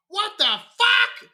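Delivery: put-on high, slow little-kid voice; toddler voice. Dad imitates his toddler swearing after falling back, high and slow. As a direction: squeak it out like a surprised toddler. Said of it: "she goes".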